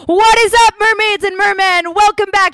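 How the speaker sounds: high, loud podcast hello; excited greeting. Acted. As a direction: greet your fans with a big burst.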